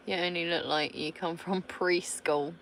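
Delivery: ordinary talk, nothing marked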